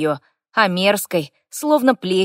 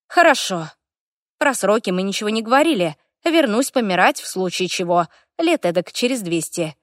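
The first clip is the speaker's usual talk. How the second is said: plain reading voice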